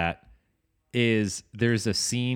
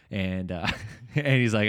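ordinary talk

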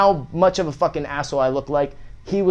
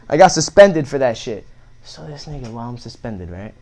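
ordinary talk

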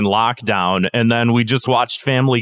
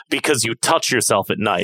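flat deadpan; 2 semitones lower than usual. Shown as a demonstration. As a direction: say it dead straight, no push. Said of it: "He says it flat".

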